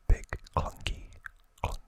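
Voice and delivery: normal talking voice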